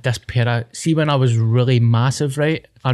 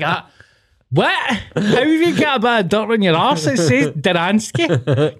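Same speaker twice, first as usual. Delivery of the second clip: mum, disbelieving; 7 semitones higher than usual. Retold or imitated. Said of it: "And she's like".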